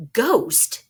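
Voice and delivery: high loud disbelieving "Ghost?"; startled gunman doubting; incredulous. Acted. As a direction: scoff in disbelief.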